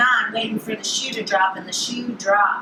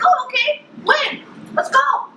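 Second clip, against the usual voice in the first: eager, high and loud; excited; 3 dB louder than usual. Retold or imitated. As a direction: jump at the invitation. Eager reply to camping invite.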